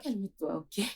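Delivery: soft, hushed plea; soothing. Acted. Wife calming her raging husband, soothing. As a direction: gently try to settle him.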